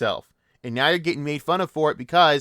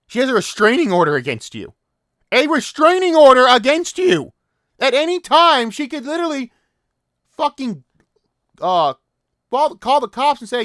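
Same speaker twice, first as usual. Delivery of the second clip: outraged shout; 11 semitones higher, 8 dB louder than usual. Real, not acted. Outraged rant at an abuser.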